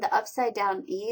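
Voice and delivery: ordinary talk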